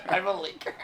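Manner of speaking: laughing through the words. Real, not acted. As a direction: giggle the line back. Repeating the joke, laughing.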